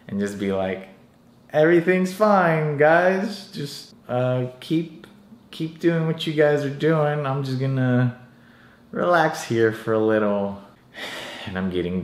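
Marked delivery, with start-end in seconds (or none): 1.5-10.6 mock nonchalant cover while about to pass out; fake casual reassurance. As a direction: brush it off brightly to the gym crowd, acting fine. Dizzy, faking calm at gym.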